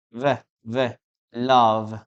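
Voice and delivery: V buzzed alone, then LOVE; correct pronunciation. Shown as a demonstration. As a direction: isolate the voiced V, then end LOVE on it. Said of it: "We say".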